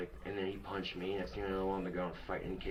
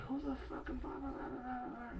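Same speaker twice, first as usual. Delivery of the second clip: high-pitched mock rant trailing into blahs; mock lecturing dad; 5 dB quieter than usual. Son mimics dad catching him smoking, high mock lecturing. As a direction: imitate a nagging lecture in a squeaky high voice. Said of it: "starts lecturing me".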